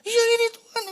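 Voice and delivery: put-on high, loud childish whine; child whining. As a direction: whine like a sulky kid. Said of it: "Teriak-teriak kan".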